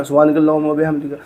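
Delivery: very fast slurred sana; mock hurried mumble of the sana; rushed recitation. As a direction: imitate people gabbling the prayer. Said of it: "बहुत सारे लोग हैं ना".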